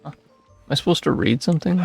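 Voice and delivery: normal talking voice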